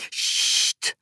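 sharp whispered hush; a girl hushing her noisy friend in the dark; whispered shush. A narrator's reading. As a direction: hush him urgently under your breath. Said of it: "deed Sisi".

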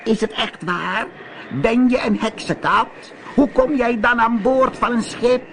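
high witch voice, surprised; sea witch calling. A narrator's reading. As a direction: ask it like a curious witch. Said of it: "De zeeheks hoorde hem en riep".

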